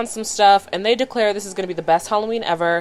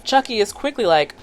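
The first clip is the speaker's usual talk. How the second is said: ordinary talk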